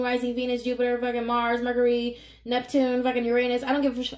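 normal talking voice

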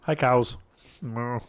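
ordinary delivery

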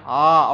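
long "ஆ" with the mouth wide open; open-mouthed vowel. Shown as a demonstration. Teacher showing students the open-mouth vowel, open "ஆ". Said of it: "வாயை நீங்க திறந்து பாருங்க".

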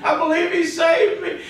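high, pleading mock whimper; panicking guy. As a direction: beg the preacher like a scared dying man. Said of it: "one of them panicking guys".

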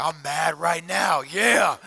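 low, gruff mock shout of a mad listener; mock angry shout. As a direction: play the angry churchgoer, loud and low. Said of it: "some of you are like".

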